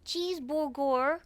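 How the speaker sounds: slow, drawn-out put-on American accent; hammed-up American R sounds in "Cheeseburger". Shown as a demonstration. [name] asked to show off her accent, put-on American. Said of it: "Can you do an American accent".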